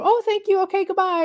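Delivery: high, chirpy quick thank-you; mock breezy brush-off. Dodging the accountant's praise with a chirpy exit. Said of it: "not to go".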